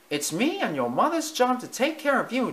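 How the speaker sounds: high, firm little mouse voice; determined mouse voice. Acted. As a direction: speak up with resolve. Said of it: "Timothy said with determination".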